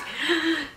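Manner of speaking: short, high gasp; mock gasp. Reader reacting to a scene in the book, mock-shocked gasp. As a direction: gasp as if taken aback.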